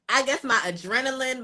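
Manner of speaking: normal talking voice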